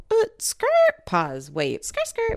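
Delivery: mock brakes screech.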